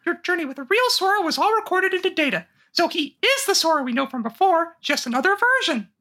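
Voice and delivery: high squeaky chipmunk voice; funny animal voice. Acted. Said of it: "How are we gonna do these voices".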